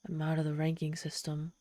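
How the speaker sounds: soft whispered despair. Acted. Demon whispering her ruin to the human. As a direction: let the loss sink in, hushed. Demon realises she is finished.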